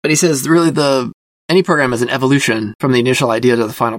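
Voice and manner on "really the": ordinary talk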